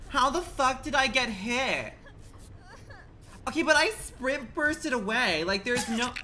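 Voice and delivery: high, loud, slow incredulous cry; laughing loud disbelief; indignant disbelief. Real, not acted. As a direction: protest the hit in disbelief. Gets hit, indignant.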